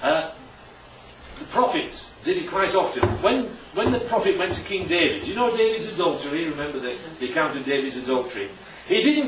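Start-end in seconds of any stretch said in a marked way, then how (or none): none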